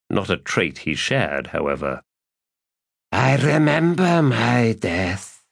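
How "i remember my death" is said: undead dragon voice. Acted.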